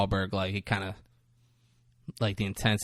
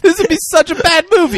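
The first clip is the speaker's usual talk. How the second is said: high, loud, fast laughing exclamation; laughing shout; 10 dB louder, faster than usual. Real, not acted. Mocking a bad plot twist, shouting with laughter. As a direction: yell it out between laughs. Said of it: "mocking the plot idea".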